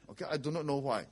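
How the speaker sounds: normal talking voice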